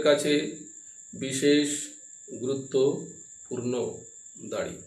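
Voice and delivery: slow dictation with pauses between phrases; dictating. Real, not acted. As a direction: read it out phrase by phrase for notes. Teacher dictates notes to class.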